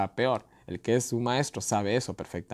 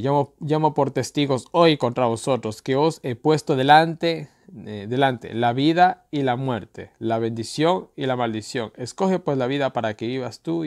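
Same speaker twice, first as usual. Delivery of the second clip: louder, slow emphatic reading; scripture reading; 3 semitones higher, 6 dB louder than usual. Acted. Preacher reading heaven and earth as witnesses, emphatic. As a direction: lay the choice before them.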